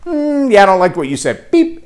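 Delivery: high, dismissive robotic brush-off; mock social media. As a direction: play the platform cutting you off with a beep. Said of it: "social media to go".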